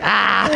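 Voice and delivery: high mock yelp of pain; high-pitched imitation of a scorched friend; imitated pained yelp. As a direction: yelp like you're on hot metal. Imitating friend on hot car.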